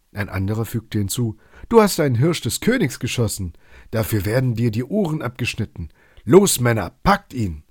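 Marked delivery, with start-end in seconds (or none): 1.7-7.6 louder and higher, rallying the men; commanding shout. Acted. Forester orders the men to seize Robin, shouting. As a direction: bark the order to seize him.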